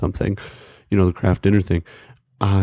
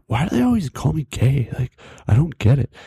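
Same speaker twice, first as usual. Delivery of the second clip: higher-pitched, put-out friend; imitated baffled complaint; 7 semitones higher than usual. Puzzled friend asking why they tease him, mock bafflement. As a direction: whine it like a confused buddy.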